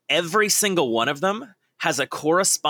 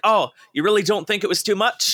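higher-pitched acted question; lightly acted, raised-pitch query; imitated questioning character; 6 semitones higher than usual. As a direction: play someone asking for reassurance. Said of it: "And he's like".

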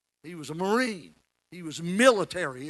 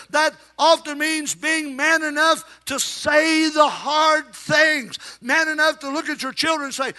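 raised, higher preaching voice, near shouting; full-force preaching; 8 semitones higher, 4 dB louder than usual. Acted. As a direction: preach it hard to the congregation.

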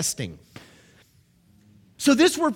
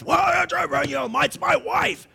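mock husband; 8 semitones higher than usual. Mimicking a husband blaming wife.

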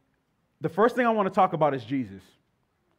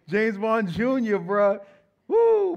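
higher, louder hype, whooping; excited cartoon nostalgia; 5 semitones higher, 4 dB louder, much slower than usual. Real, not acted. Preacher hyping the congregation about Saturday cartoons, whooping. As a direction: get the crowd hyped remembering cartoons.

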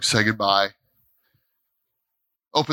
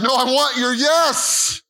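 higher, slow, insistent plea; God insisting; 7 semitones higher, slower than usual. Retold or imitated. Preacher voicing God asking him for full surrender, slow and insistent. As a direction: press for the real yes. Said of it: "and God's like".

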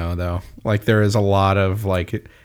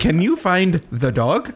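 slow, high mock kiddie-show question; exaggerated talking-to-a-child voice; children's cartoon presenter; 6 semitones higher, slower than usual. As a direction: ask a toddler, drawing out each word. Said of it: "like you're a fucking child watching a cartoon".

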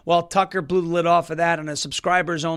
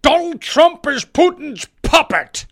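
mock-outraged voice of the left; 8 semitones higher, 5 dB louder than usual. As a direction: sneer at the left's talking point. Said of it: "everyone on the left wants to say".

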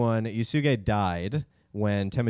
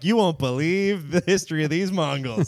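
clickbait voice; 6 semitones higher, 4 dB louder than usual. Pretend. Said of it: "They're like".

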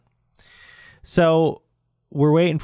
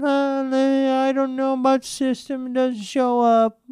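mock clueless clerk; 13 semitones higher than usual. Store rep fobbing off a customer, mocking high voice.

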